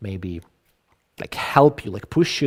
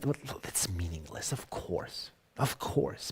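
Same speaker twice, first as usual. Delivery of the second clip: whispered, much softer throwaway; mock cynic aside; 5 semitones lower, 12 dB quieter, slower than usual. Teacher voicing a cynic on small talk, whispered softly.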